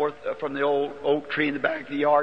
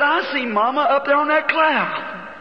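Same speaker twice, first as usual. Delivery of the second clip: put-on child's wonder; 10 semitones higher than usual.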